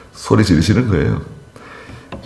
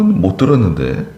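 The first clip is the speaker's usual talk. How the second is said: usual speaking voice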